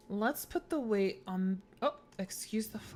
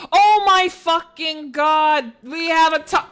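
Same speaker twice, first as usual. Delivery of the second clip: excited shout; 7 semitones higher, 15 dB louder than usual. Real, not acted. Player shouting to chat on finding the missing piece, excited. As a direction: yell it out as the answer clicks.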